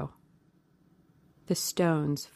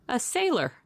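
shocked cry; 7 semitones higher than usual. A narrator's reading. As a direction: gasp out the word sailor in shock. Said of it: "he cried out".

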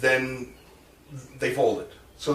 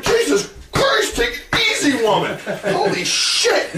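shocked reaction; 14 semitones higher, 4 dB louder than usual. Retold or imitated. Teller re-enacts his alarm at the trainee's yell, hammed-up. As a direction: play up the shock, half laughing. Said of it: "I'm like".

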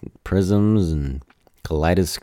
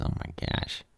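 whispered aside; 12 dB quieter than usual. Real, not acted.

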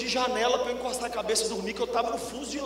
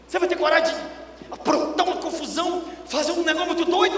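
higher and louder, pushing the crowd; building challenge; 6 semitones higher, 3 dB louder than usual. Real, not acted. Preacher daring the church to do crazy things, rising and louder. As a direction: dare them, rising.

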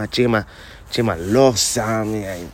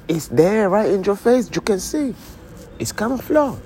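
high put-on voice; 11 semitones higher than usual. High voice insisting about planes.

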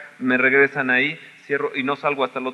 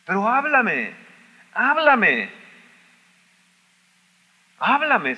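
pleading; 7 semitones higher, much slower than usual. Acted. Pleading prayer repeated.

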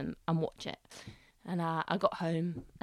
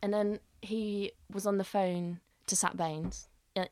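ordinary talk

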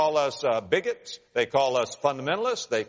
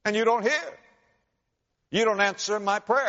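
pleading prophet; 9 semitones higher than usual. Retold or imitated. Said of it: "And Habakkuk says".

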